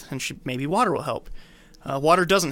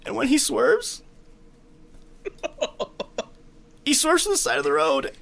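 hammed-up sad narration; 8 semitones higher, 5 dB louder than usual. Shown as a demonstration. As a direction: overplay tragedy for laughs. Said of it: "Louder and sadder".

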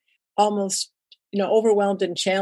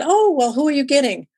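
innocent question; 6 semitones higher than usual. Retold or imitated. To the organiser who cancelled, mock-innocent higher question. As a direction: ask sweetly, playing naive. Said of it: "I kinda innocently said".

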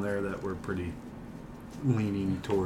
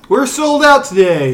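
loud; 13 semitones higher, 10 dB louder than usual. Acted. Mock announcement, sports talk.

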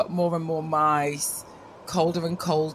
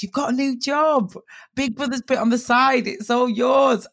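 high, excited delivery of big news; excited announcement; 6 semitones higher, 4 dB louder than usual. Retold or imitated. Agent telling client about a new job, excited. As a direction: break the good news, raised and thrilled.

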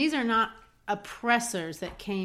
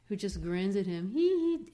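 innocent giggle. Retold or imitated. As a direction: giggle innocently like a small boy.

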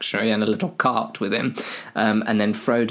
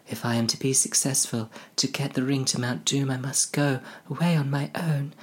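soft, higher hobbit voice; earnest Frodo; 4 semitones higher, 4 dB quieter than usual. Acted. Frodo decides to go alone, soft high voice. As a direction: resolve it quietly in a higher voice.

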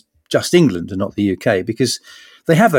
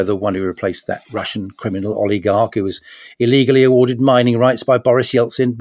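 ordinary delivery

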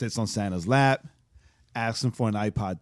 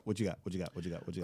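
each repeat softer and lower; fading echo; 6 semitones lower, 12 dB quieter, much faster than usual. Pretend. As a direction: let the question fade out like an echo.

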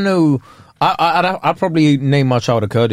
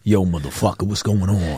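low, quieter put-on American accent; 9 semitones lower, 5 dB quieter than usual. Brit abroad slipping into slang, low mock American accent. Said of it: "they got American accents".

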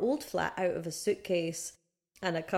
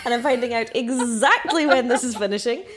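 louder, indignant entitled-customer voice; mock Karen demand; 5 semitones higher, 7 dB louder than usual. Retelling her complaint about the building works in a hammed-up louder Karen voice. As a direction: play up the outraged complainer demanding answers. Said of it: "pregnant Karen on day one".

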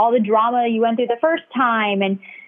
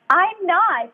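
insistent high; 8 semitones higher than usual. Retold or imitated. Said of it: "And I'm like".

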